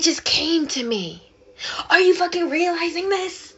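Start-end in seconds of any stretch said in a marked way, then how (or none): 1.9-3.5 worked-up, high-pitched exasperated yell; high, heated outburst; yelling at self. Real, not acted. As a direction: demand it, yelling in frustration at yourself. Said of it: "I'm, like, yelling at myself".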